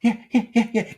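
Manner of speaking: high, meek yes-man voice; pitch jumps up. Acted. Workmate answering the manager, suddenly high and submissive. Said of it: "His, his pitch changes".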